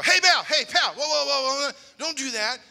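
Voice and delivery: high, loud, drawn-out whoa to the gunman; yelling to stop. Retold or imitated. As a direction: shout him down before he fires again. Yelling at the shooter.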